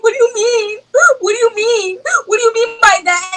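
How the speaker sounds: high, sobbing re-enactment of an upset question; tearful pleading. Retold or imitated. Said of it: "I start crying 'cause I'm very emotional".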